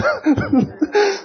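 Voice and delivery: high-pitched burst of laughter; laughing. Real, not acted. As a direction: laugh at your own funny memory. (خندهٔ زیر و کوتاه)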